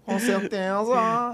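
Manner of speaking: high, drawn-out duck-voice parody. Acted. Co-host impersonating Moro for a laugh, duck voice. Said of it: "E com voz de pato".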